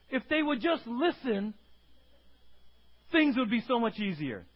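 put-on high, loud venting about the kids; exasperated parent. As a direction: act out a parent at wit's end. Voices exasperated parent of a kid.

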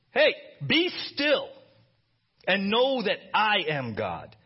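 loud, slow divine command; commanding God. Acted. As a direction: call out slowly like God himself. God's voice, preacher acting.

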